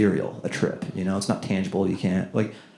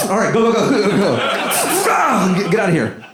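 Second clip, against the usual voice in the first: urgent shouting; 13 semitones higher than usual. Retold or imitated. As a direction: rush someone like a pit crew boss. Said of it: "Just like".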